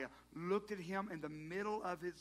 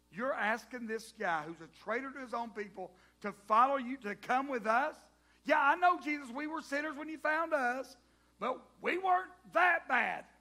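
higher, louder, slowing indignant whine; indignant protest; 8 semitones higher, 12 dB louder than usual. Acted. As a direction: protest with wounded pride. Disciples protest to Jesus.

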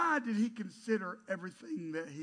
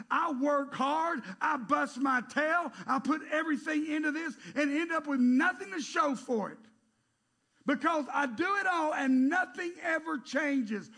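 Solomon; 8 semitones higher, 7 dB louder than usual. Acted. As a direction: cry out in exasperation at fruitless work. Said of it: "feel the frustration coming through Solomon's writing".

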